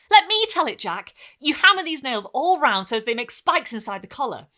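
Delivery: put-on high, loud eager boy; excited boy. A narrator's reading. Boy insisting on telling it, high and loud. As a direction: grab the story back. Boy demanding his turn.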